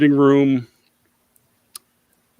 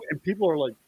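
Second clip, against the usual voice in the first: ordinary talk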